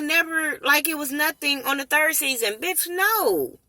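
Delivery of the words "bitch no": exasperated dismissal. Real, not acted. Reacting to cast reconciling.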